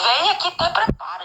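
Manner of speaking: mock firm command, pitched high; firme. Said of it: "Ele foi firme comigo". (ordem firme imitada)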